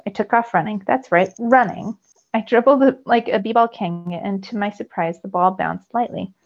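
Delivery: usual speaking voice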